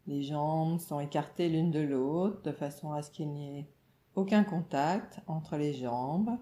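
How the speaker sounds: usual speaking voice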